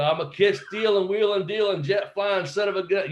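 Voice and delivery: high-pitched wrestling promo patter; Ric Flair. Retold or imitated. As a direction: rattle off the boast like a showboating wrestler. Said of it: "I started cutting a couple Ric Flair promos".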